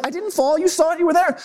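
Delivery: high-pitched protest, retold; indignant insistence. Husband defending himself to his wife, high acted indignation.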